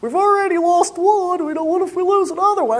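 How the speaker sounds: high, fretful put-on voice of a worrier; fussing shepherd asks about the sheep, high and fretful; critical shepherd. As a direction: play the nagging one in a high whine. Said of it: "He's like".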